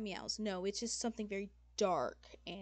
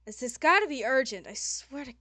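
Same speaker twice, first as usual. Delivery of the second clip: loud, higher, exasperated groan into a rant; cranky demon lady, just woken; 5 semitones higher, 14 dB louder than usual. Acted. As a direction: grumble loudly at being woken up. Game master voices irritated demon lady.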